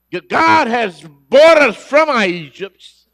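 high, loud, slow proclamation; preaching shout. Acted. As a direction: cry it out to the whole church.

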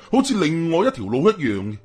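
plain reading voice

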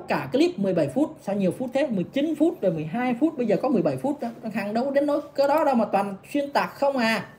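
ordinary talk, nothing marked

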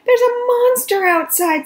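high, loud frightened cry. Acted. Baby telling her sister about the monster, frightened and high. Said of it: "said Baby".

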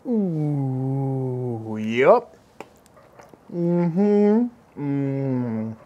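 savoring delicious food. Real, not acted. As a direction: hum with pleasure over the bite. First bite of rabbit.

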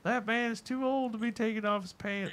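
high, outraged busybody voice; mock scandalised onlooker. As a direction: play a shocked old bystander calling for arrest.

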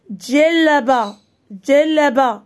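"جلابة" slowly stretched out; teacher slowly sounding out "جلابة"; syllable by syllable. Shown as a demonstration. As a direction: draw out each syllable of "جلابة". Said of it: "واش عندي ال؟".

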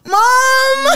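high, loud wailing cry for Mom; hammed-up crying call. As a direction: re-enact a tearful shriek to mother. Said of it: "And I'm crying".

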